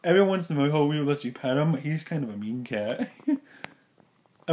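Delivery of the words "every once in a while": ordinary delivery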